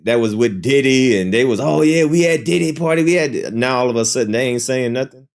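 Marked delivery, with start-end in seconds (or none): 1.6-3.5 higher-pitched imitation of excited boasting; mock bragging. Imitating guests who bragged about [name]'s parties, mock boastful. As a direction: mimic party guests showing off, pitched up. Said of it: "y'all was bragging".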